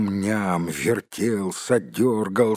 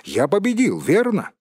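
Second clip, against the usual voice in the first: high, pressing question; impatient; 10 semitones higher, 4 dB louder than usual. A narrator's reading. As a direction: push for an answer, pitch raised. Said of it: "не выдержал Бильбо". (нетерпеливо, с напором)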